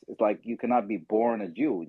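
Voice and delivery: normal talking voice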